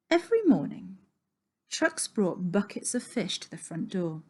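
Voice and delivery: plain reading voice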